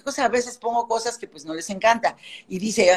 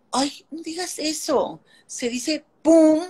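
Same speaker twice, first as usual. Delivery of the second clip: high, slow, prim correcting voice; mocking a prudish friend's correction, high and slow; prissy friend correcting; 6 semitones higher, slower than usual. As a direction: imitate a fussy friend, higher and slowed.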